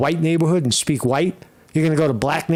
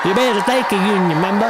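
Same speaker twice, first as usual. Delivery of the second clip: loud, high-pitched parody of a put-on Southern accent; mock Southern accent; 6 semitones higher, 3 dB louder than usual. Host mimicking Harris's speech to union members, mock Southern accent. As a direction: shout it in an exaggerated fake Southern accent. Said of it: "a fucking accent".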